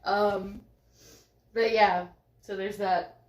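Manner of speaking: ordinary talk, nothing marked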